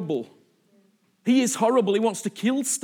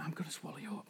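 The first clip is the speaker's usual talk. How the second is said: barely audible, much softer threat; softly voiced darkness closing in; hushed menacing whisper; 5 semitones lower, 19 dB quieter, much faster than usual. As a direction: breathe it like creeping darkness.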